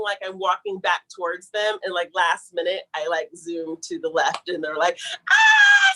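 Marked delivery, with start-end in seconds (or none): 5.3-5.9 high, loud shriek of excitement; friends screaming. Retold or imitated. Friends shriek as she slips past them, loud scream. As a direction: let out a piercing scream. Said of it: "Screaming, screaming".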